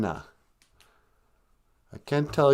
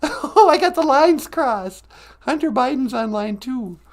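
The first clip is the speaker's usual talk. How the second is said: very high squeaky question; 12 semitones higher, 4 dB louder than usual. Acted. The sidekick squeaks a name at the host. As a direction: chirp it like a puppet.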